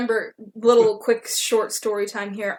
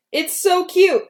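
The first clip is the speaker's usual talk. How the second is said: excited coo; 9 semitones higher, 6 dB louder, slower than usual. Retold or imitated. Cooing over chihuahua.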